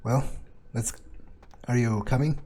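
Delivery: ordinary talk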